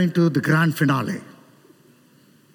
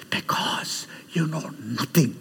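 slow, low stage whisper; imitated dramatic whisper; 7 semitones lower, 6 dB quieter than usual. Visiting preacher answering his own question to students, dramatic whisper. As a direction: whisper the verdict slowly.

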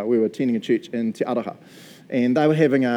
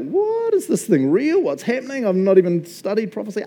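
high-pitched mock panic; freaking out; 8 semitones higher than usual. Stunned skeptic watching prophecy come true, mock freaking out. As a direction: retell it as a squeaky, bewildered freak-out. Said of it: "I'm now freaking out".

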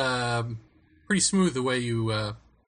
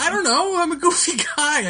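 giggling; 12 semitones higher, 4 dB louder than usual. Real, not acted. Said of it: "I just laugh at shit".

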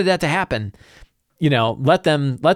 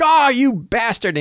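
higher, slow indignant orc curse; angry orc; 9 semitones higher, slower than usual. Acted. Pinned orc cursing the sentinel who stopped him, slow and indignant. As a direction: drag out the orc's outrage at being pinned.

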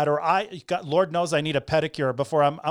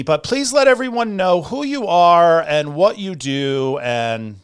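normal talking voice

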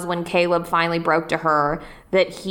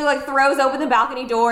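normal talking voice

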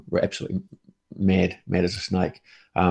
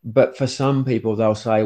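ordinary talk

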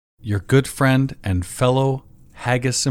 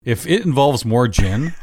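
ordinary talk, nothing marked

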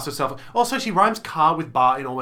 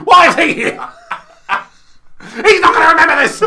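loud, high angry shouting; aggressive yell; 11 semitones higher, 11 dB louder than usual. Retold or imitated. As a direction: shout them down impatiently. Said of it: "you were really aggressive".

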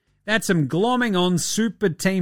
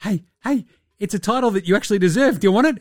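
higher, taunting voice of the basketball gods dangling a title; sing-song divine tease, pitched up; teasing basketball gods; 4 semitones higher, faster than usual. Retold or imitated. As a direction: lure him in like a cruel trickster god. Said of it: "it's just the basketball gods going".

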